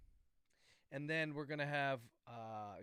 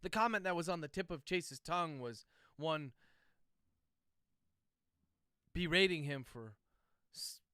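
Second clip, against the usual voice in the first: dictating; 4 dB louder than usual. Real, not acted. Author dictating novel chapter.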